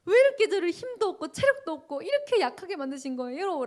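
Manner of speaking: high, loud, tearful pleading; retelling a wailing prayer, high and loud; crying prayer. Retold or imitated. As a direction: cry out to God through sobs. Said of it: "제가 막 엉엉 울기 시작했어요".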